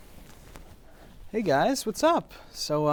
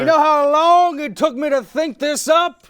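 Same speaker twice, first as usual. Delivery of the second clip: higher and louder, offended poet; indignant outburst; 13 semitones higher, 12 dB louder than usual. Acted. Poe snapping at the host who doubts him. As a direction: bristle with wounded pride.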